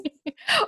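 high bright giggle of joy; delighted laugh. Real, not acted.

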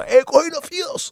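high-pitched mock war cry; re-enacted battle cry. Storyteller attacking the man in Burger King, high mock battle cry. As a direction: cry it out high, like a gang chant.

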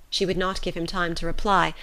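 ordinary delivery, nothing marked

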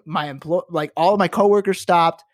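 normal talking voice

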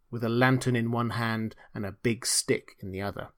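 plain reading voice

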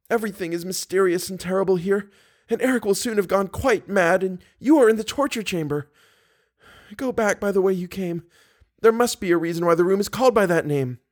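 high-pitched pleading heroine; Christine, frightened captive woman. A narrator's reading. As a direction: warn fearfully in a high female voice.

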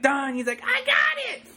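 triumphant cheer. Retold or imitated. Elephant announcing he fixed it, gleeful and high. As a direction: crow it out like you just saved the day.

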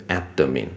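“admin”念成ad-de-min三个音节; 在“admin”的d后插一个“的”; d后多加母音. Shown as a demonstration. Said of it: "跟admin差太多了". (错误示范“admin”，d带出元音)

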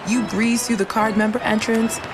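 ordinary delivery

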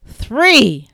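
loud, high shouted three; shouted count. Acted. Couple counting down a ski race, shouted three. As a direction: fire the start of the race.

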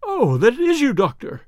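high, loud relieved cry; frightened patient recognising the doctor, high and relieved; great relief. Acted. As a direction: let the fear go out in relief. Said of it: "with a great heave of relief".

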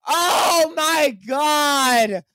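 high, long-held wail sinking gradually; drawn-out lament. Real, not acted. Host lamenting a spoiled game score, drawn-out wailing. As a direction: wail slowly in dismay, easing down.

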